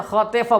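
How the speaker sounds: खतीफा with "tay" in the middle; wrong-example "ay" vowel in खतीफा; majhool vowel. Shown as a demonstration. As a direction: say खतीफा as "kha-tay-faa". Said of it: "न खतीफा बोलना है".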